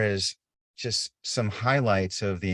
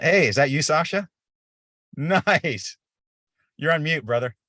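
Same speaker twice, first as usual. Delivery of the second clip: higher, brighter-pitched hail to someone just arriving; calling out to a colleague; 7 semitones higher, 4 dB louder than usual. Real, not acted. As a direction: break off and greet him, pitched up. Spots colleague joining the call, calls out.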